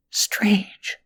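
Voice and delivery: breathy whisper of puzzlement. Acted. A puzzled boy whispering to himself. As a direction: whisper it to yourself.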